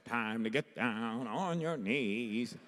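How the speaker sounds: slow, nasal singer imitation. Imitating the singer on her record, nasal drawl.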